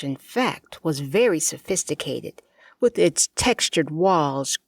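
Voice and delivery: ordinary delivery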